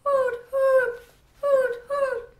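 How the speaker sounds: high hooting cry of an owl; owl hoots. A narrator's reading. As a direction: hoot like a night owl, high and loud. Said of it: "Then I heard".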